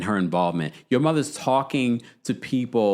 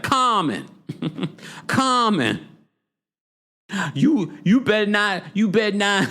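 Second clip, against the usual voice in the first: a playful warning, pitched up; Mama Joyce impression; 9 semitones higher than usual. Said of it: "I could have did a better impression".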